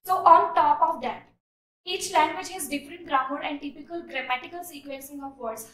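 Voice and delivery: slow, deliberate read-aloud; measured classroom reading; slow essay reading. Shown as a demonstration. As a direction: read the line out for students. Reads essay sentence.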